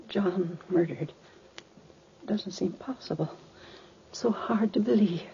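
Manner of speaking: soft, halting, grief-stricken; hushed disbelief. Acted. Mother absorbing news of John's murder, numb and hushed. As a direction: let it fade almost to a whisper.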